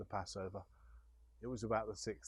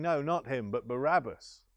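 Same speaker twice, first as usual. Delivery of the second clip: loud crowd cry, raised and forceful; crowd shouting; 4 semitones higher, 7 dB louder than usual. Acted. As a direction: shout it back at Pilate as the crowd. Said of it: "And they shouted".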